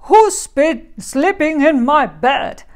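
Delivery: very loud, gruff bear growl; growling big bear. A narrator's reading. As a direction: growl out the question as Papa Bear. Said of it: "growled the great big bear in his deep voice".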